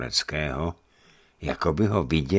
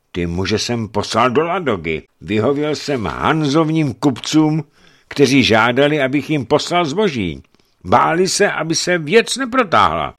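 high, loud, urgent explanation; accused boyar pleading his case; defensive boyar; 11 semitones higher, 8 dB louder than usual. Acted. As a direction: justify yourself under accusation.

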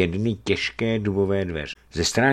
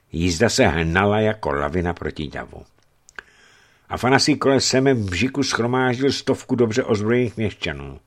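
ordinary delivery

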